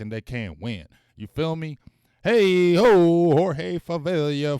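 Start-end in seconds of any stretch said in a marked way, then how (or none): 2.2-4.6 sudden loud hype shout; loud call-out. Real, not acted. Host hollering thanks to a chat donor, loud.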